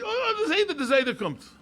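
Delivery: high excited cry of oh, the Zayda; excited call. Retold or imitated. Father at the airport deck, excitedly calling out to his sons. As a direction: point at each plane and call out in joy. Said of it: "my father would say".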